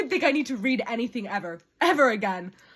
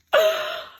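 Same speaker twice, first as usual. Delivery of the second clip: sharp, high-pitched squealed gasp; high crying gasp; 4 dB louder than usual. Real, not acted. Reader overcome by love declaration, high gasp. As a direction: gasp high, as if about to cry.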